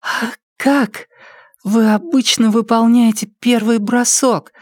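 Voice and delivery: high, drifting-off voice; fading into sleep mid-question; sleepy girl. A narrator's reading. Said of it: "потому что заснула".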